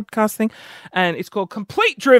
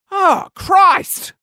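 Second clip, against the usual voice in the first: high, comic exasperated cry; exasperated outburst; 9 semitones higher than usual. Acted. Diet leaves only hot water, exasperated outburst. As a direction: cry out, fed up, pitched high.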